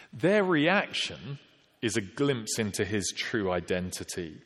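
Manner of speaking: ordinary talk, nothing marked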